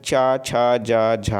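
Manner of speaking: च, छ, ज, झ drawn out one by one; slow teacher's drill of च, छ, ज, झ; pronunciation demo. Shown as a demonstration. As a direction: sound out च, छ, ज, झ very slowly with the tongue. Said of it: "जब जीभ से उच्चारण होता है".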